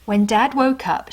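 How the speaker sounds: ordinary delivery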